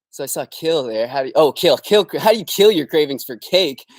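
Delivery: ordinary talk, nothing marked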